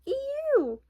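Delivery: very high squealing eeew; hog squeal. A narrator's reading. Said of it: "who screamed".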